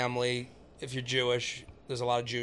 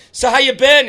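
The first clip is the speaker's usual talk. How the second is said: loud, high, overly friendly; hammed-up loud small talk; pushy chatty stranger; 10 semitones higher, 14 dB louder than usual. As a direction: barge in on someone with headphones. Voicing a pushy talker.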